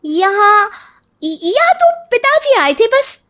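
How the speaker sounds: put-on high, faltering framing of the old man; fake hesitant blaming. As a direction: stammer the lie up high. Wife falsely blames father.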